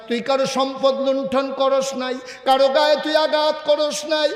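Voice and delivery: impassioned declaration. Acted. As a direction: proclaim his innocence with rising force. Prophet declares his innocence.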